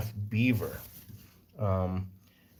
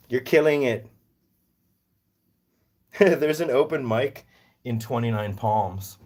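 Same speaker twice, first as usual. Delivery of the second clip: ordinary delivery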